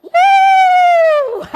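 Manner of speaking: long, very high, loud "woo"; whooping laugh. Shown as a demonstration. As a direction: hold a piercing whoop, then fall into laughter. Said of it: "laugh for one minute".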